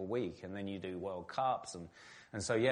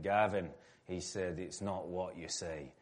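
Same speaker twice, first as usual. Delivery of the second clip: put-on Scottish accent; 5 semitones lower, 4 dB quieter than usual. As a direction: mimic a Scottish pundit's broad accent, low and unhurried. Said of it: "in his broad Scottish accent".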